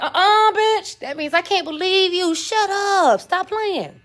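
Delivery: playful disbelief; disbelieving bitch. Shown as a demonstration. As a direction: scoff like you don't believe a word. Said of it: "Then you be like".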